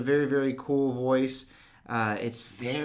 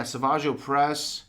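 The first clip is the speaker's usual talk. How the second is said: ordinary talk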